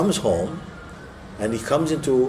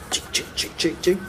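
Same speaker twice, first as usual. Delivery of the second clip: digging noises; 7 semitones higher, 4 dB quieter than usual. Retold or imitated.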